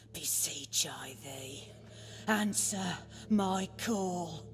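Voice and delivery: hushed, slow summoning chant; quiet incantation to the ladies; ritual invocation. Acted.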